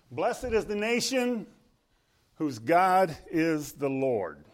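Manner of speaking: slow, loud proclamation of a verse; stately, drawn-out preacher's recitation; scripture declamation. Real, not acted. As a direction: proclaim it high at first, stretching each phrase.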